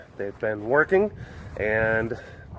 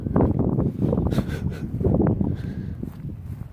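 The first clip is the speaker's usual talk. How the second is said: breathy laugh; 3 dB quieter than usual. Real, not acted.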